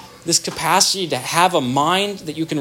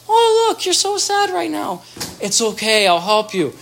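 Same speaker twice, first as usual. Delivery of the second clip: very high cooing, then settling into reassurance; soothing marked mirroring; 10 semitones higher than usual. Shown as a demonstration. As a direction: coo high, then steady and comforting. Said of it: "But if mom can go".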